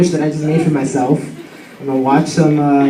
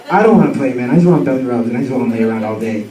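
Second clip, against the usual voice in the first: lightly voiced sluggish dog, sinking low and softer; lazy dog's voice; 6 semitones lower, 4 dB quieter, faster than usual. Dog refusing her owner's offer to play, lightly voiced lazy dog. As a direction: voice a dog who won't be bothered, lightly.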